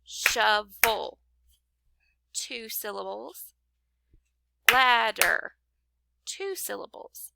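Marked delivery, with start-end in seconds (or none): 0.1-1.2 "Shovel" broken into shov and el; two distinct beats on "Shovel"; clapped-out syllables. Shown as a demonstration. Teacher clapping for pupils, "Shovel" in two separated beats. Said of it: "if we clap it out". 4.7-5.5 "Ladder" broken into lad and der; two punched beats on "Ladder"; clapped-out syllables. Shown as a demonstration. Teacher clapping for pupils, "Ladder" in two separated beats. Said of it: "if we clap it out".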